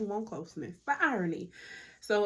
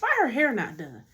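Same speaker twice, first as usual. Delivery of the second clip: mock viewer question; 6 dB louder than usual. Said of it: "That's what I'm gonna ask".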